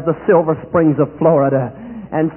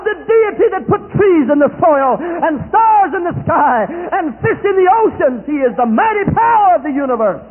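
preaching at full force; 7 semitones higher than usual. Acted. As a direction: shout the glory to the whole congregation. Preaching climax, shouted proclamation.

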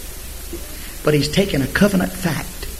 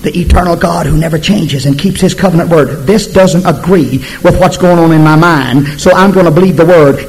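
ordinary talk

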